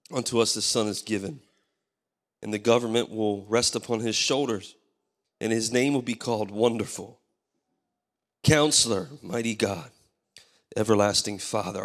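ordinary talk, nothing marked

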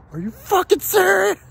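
very high, strained retold outburst; high-pitched, incredulous explosion; outraged disbelief. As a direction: squeal it in mock outrage. Acted outraged reply to prank reveal.

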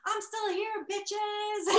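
high, loud comeback cry; defiant triumphant shout. Retold or imitated. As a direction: holler it high to the whole room. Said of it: "And she was like".

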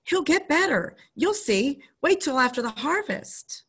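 put-on high, pleading child's voice; insist, voice lifting high; pleading boy. Boy begging the doctor to wait, high and pleading.

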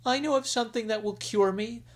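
put-on a young dragon queen coaxing her husband; sick young queen. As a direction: beg sweetly as the ailing bride. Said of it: "the sick queen said".

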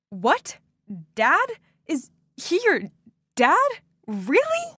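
stunned, voice climbing to a squeak; disbelieving high Really; shocked disbelief. Retold or imitated. Shocked discovery of Linda's dad.

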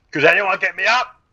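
loud, high-pitched call out; calling for help. Real, not acted. Downed gamer asking teammates for a revive, high loud call. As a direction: raise the voice to summon help.

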